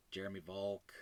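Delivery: ordinary talk, nothing marked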